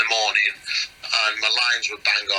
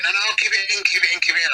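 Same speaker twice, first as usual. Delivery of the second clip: higher, insistent "keep it in"; eager urging; 9 semitones higher, much faster than usual. Retold or imitated. Ricky Gervais urging the actor to keep the line in, eager. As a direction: urge him on, delighted.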